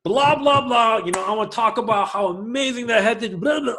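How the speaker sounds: mock live greeting. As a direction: play a chirpy host opening a live stream. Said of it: "Go live now".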